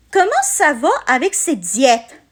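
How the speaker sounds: ordinary talk, nothing marked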